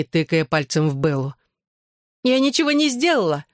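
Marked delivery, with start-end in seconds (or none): 2.2-3.4 high, mock-innocent protest; laughing denial. A narrator's reading. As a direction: play innocent with amusement, no real laugh. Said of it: "засмеялась она". (насмешливо, высоким голосом)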